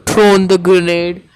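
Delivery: loud, slow, forceful words; frustrated self-reproach. Real, not acted. Gamer scolding self over the grenade, loud frustrated emphasis. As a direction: hammer out each word in frustration.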